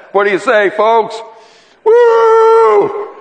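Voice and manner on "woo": high, loud joyful whoop; excited whoop. Real, not acted. Preacher whooping to rouse the congregation, loud and high. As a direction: let out a big celebratory woo. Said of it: "preacher cheers the congregation".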